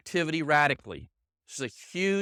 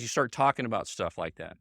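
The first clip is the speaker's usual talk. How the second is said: ordinary talk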